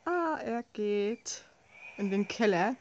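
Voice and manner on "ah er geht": usual speaking voice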